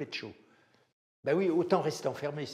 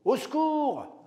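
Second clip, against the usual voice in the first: much higher, much louder yell for help; shouted cry for help; 11 semitones higher, 8 dB louder, much slower than usual. Retold or imitated. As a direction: scream it like calling for rescue in a bar. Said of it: "c'est ce que j'ai crié".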